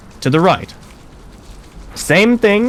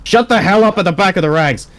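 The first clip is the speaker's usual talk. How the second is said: barked order; 6 semitones higher than usual. Acted. Instructor silencing chatter in ranks.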